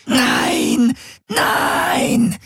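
very high shrieking Nein; screamed no. Acted. Prisoner dragged to capsule screaming, very high. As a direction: shriek it in terror. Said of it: "schrie Ylana unaufhörlich".

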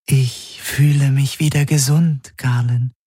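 high, level dream voice; Helix in a dream. A narrator's reading. Helix speaking to Galen in a dream, high and level. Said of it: "hatte sie im Traum gesagt".

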